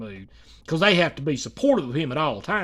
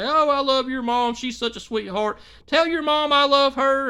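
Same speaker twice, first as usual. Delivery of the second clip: higher, sweet gushing; lightly voiced adoring fan; mock gushing fan; 9 semitones higher, 5 dB louder than usual. A chat fan praising his mom, higher and sweet.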